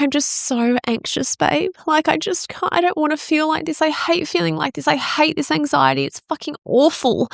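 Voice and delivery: high, pleading re-enactment of crying; retold tearful outburst. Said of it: "And I just start crying".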